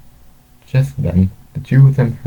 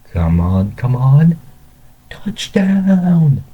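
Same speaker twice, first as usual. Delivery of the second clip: excited cheering shout; 7 semitones higher, much slower than usual. A narrator's reading. Two men shouted at the football game, excited cheering. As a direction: build from urging to a high, drawn-out cheer. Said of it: "the two men shouted so loudly".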